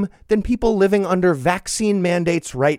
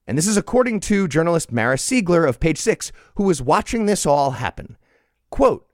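ordinary talk

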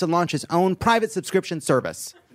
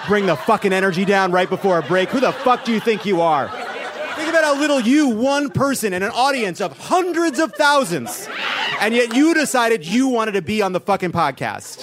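mock tirade; 3 semitones higher than usual. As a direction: scold the heckler in fake fury. Berating audience member in jest.

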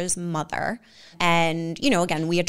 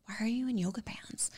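soft, hushed whisper of a puzzled question; whispered quote; 4 semitones higher, 12 dB quieter than usual. Retold or imitated. Quoting fiancé to the host, hushed whisper. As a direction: murmur it under your breath. Fiancé asks about her outfit.

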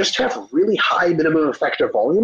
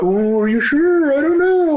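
high, loud, slowed mock of a timid doubter; 10 semitones higher, 3 dB louder, slower than usual. Mocking the evidence-based crowd as a fretful doubter. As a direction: whine it out like a hesitant skeptic, rising higher. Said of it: "they're like".

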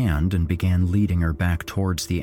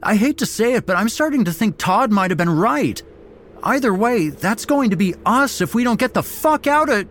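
high, loud, frantic argument; exclaiming; 5 dB louder than usual. A narrator's reading. Kendrick argues they must flee, heated and panicked. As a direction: rant in panic until cut off. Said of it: "Kendrick exclaimed".

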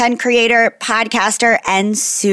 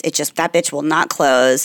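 ordinary delivery

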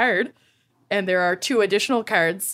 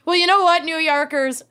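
indignant; 8 semitones higher than usual. Acted. Mock rant at New Yorkers.

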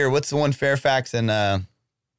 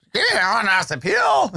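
shouting demand; 8 semitones higher than usual. Pretend. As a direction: shout it out at them. Pleading prisoner demands appeal.

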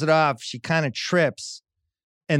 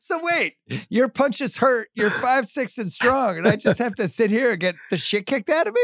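mock outraged protest; 9 semitones higher than usual. Retold protest to son.

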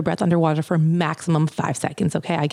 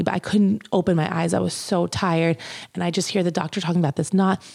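ordinary delivery

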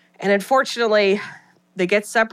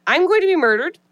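higher, louder panicked whine; mock panic; 8 semitones higher, 3 dB louder than usual. [name] refusing to stay with Gail, in mock panic, higher. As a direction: blurt it out in hammed-up alarm.

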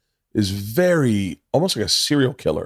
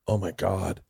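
quiet dismay; 8 dB quieter, slower than usual. Retold or imitated. Actor watching dailies while the room laughs, quiet dismay. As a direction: say it under your voice, appalled.

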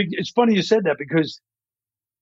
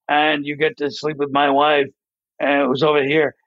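Ben Stein; 3 semitones lower than usual. Acted. Ben Stein character about his wife in a sketch, deadpan monotone. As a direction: keep every word on one note, no emotion. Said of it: "deadpan, like Ben Stein talking like this, so monotone".

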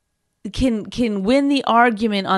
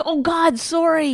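mock startled apology, pitched up; apologetic reaction; 5 semitones higher, slower than usual. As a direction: act out a concerned, instant apology.

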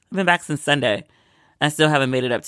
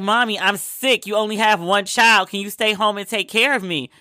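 higher-pitched, whiny plea to Mommy; retold pleading; 5 semitones higher than usual. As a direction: imitate yourself begging as a sick child. Sick only child begging her mother.

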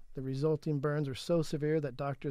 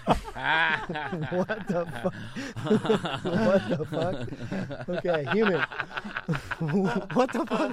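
cracking up mid-sentence; laughing; 3 semitones higher, 6 dB louder, much slower than usual. Real, not acted. Host reads bot's reply, laughing in disbelief. Reading absurd chatbot reply.

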